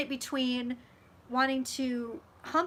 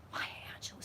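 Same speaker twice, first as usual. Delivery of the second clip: 1 gasped whisper; 14 dB quieter, much faster than usual. Real, not acted. Gasping at a commenter's name.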